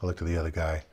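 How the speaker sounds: usual speaking voice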